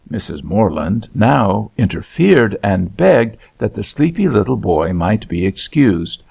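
usual speaking voice

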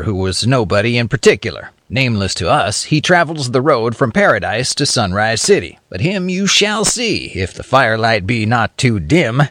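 ordinary delivery

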